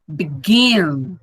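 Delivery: slow teaching "Begin", weight on the end; stress on second syllable. Shown as a demonstration. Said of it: "O begin é o mais forte".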